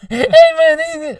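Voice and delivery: very high, cracking mock voice; high, loud failed impression; attempted Tony Woods. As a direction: try the voice and break into laughter. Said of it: "Narrated by Tony Woods".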